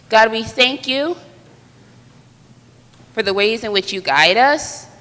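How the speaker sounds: ordinary talk, nothing marked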